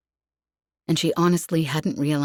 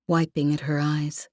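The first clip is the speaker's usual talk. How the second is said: ordinary delivery, nothing marked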